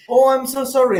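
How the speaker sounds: imitating wife. Husband mimics wife apologizing. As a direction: ham up her sorry. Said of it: "But she's like".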